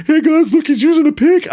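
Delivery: very high, loud schoolyard taunt; hammed-up nasal kid mockery. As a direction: point and jeer like a bratty kid. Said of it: "And they're like".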